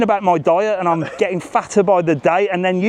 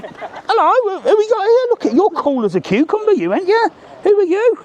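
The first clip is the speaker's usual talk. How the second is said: excited greeting; 12 semitones higher than usual. Real, not acted. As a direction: lift way up for the little one. Greeting a young boy.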